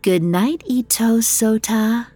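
lightly voiced, coaxing farewell; seductive. Otoyo bids the guard goodnight, mildly seductive. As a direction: linger on the name, tempting him. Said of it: "she said seductively".

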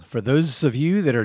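ordinary delivery

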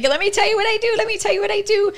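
put-on high excited child voice with a secret; eager kindergartner. Playing a kindergartner eager to tell her secret, high and excited. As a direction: bubble over like a little kid bursting to tell. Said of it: "feel like a kindergartner with a secret".

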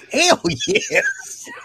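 sudden high-pitched whoop of joy; excited hell yes. Retold or imitated. Retelling his dad giving him money, high excited whoop. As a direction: yelp it like the cash just landed. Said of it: "I was like".

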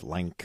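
"lank", like with a nasal n. Retold or imitated. Podcaster imitating cohost's word like, said "lank". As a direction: put an n inside like: "lank". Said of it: "every time you say the word like".

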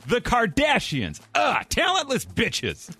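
slow, high, drawn-out all-caps read; shouting capitalized words. Shown as a demonstration. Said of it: "this is written in all caps, so I will deliver it as such".

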